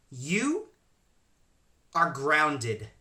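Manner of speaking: word by word, long pauses; stern, measured mom. Retold or imitated. Mom grounding her son after the call, slow and stern.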